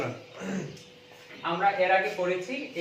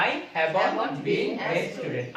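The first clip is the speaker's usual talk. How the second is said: very slow, drawn-out words; teacher dictating to the class; slow model reading; much slower than usual. Shown as a demonstration. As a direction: dictate it word by word. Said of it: "আমার সাথে বলুন".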